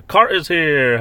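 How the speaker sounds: high, loud welcome call; excited greeting. Real, not acted. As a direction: announce an arriving guest with a shout. Hails a viewer joining live.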